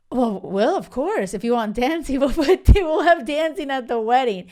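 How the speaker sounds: dad happily giving in to the dancing, voiced with a laugh; dad laughing agreement. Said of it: "And my dad".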